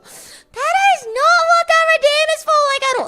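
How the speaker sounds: high, loud protesting whine; shrill outraged complaint; indignant wail. Real, not acted. Indignant at misused redeem.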